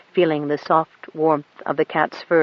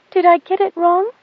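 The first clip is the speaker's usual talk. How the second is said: put-on high, timid little girl's question; fearful; 14 semitones higher, 6 dB louder than usual. A narrator's reading. Goat Dung fearfully asks the pilot, high and small. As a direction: ask it afraid of a blow. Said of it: "she asked fearfully".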